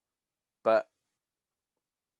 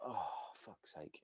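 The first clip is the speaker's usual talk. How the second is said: muttered groan; 5 semitones lower, 19 dB quieter, slower than usual. Retold or imitated. Trainee groaning at instructor.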